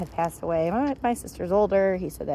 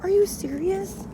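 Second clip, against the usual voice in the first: high, slow disbelieving "Are you serious?"; exasperated disbelief; 11 semitones higher than usual. Retold or imitated. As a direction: let it rise high in fed-up disbelief. Exasperated at dog pulling.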